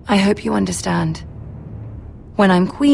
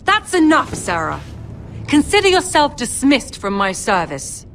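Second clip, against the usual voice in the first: higher, sharp stern rebuke; stern command; 6 semitones higher than usual. Acted. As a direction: cut them off firmly. Lady silences the quarrel.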